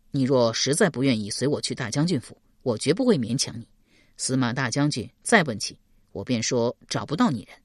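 low-pitched, steady male character; a hint of a protective official; earnest man, reassuring. 邓毅 reassures weeping 史佩. (压低嗓音，稳稳地安抚)

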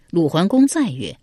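ordinary delivery

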